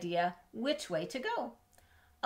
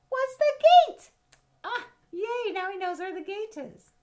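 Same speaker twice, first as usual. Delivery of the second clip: much higher and louder, delighted; excited cheer; 10 dB louder than usual. Acted. Reading to a child, cheering that Peter sees the gate. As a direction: cheer like the rabbit's found his way out.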